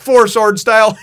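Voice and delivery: high, loud shouted comeback; mock yell. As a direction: belt it out as a ridiculous insult. Luffy tries another silly insult.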